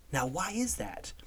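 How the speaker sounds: a sudden conspiratorial whisper. Acted. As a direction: drop to a breathy secret whisper. Asks listeners a rhetorical question.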